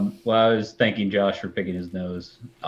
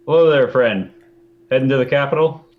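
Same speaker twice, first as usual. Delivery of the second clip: friendly loud greeting; 4 semitones higher, 7 dB louder than usual. Acted. Hailing the caravan leader in character.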